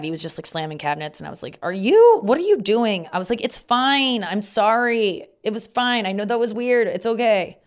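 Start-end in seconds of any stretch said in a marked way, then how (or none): 1.6-3.1 high, loud startled question; alarmed question. Retold or imitated. Girl startled as the boy slams cabinets, loud and high. 3.4-7.6 high, louder hurried reassurance; reassuring. Retold or imitated. Girl reassuring the embarrassed boy, high and louder.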